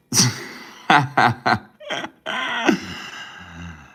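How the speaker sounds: high, loud burst of laughing; real laughter. Real, not acted. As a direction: crack up hard, then tail off. Reacts to something funny in chat.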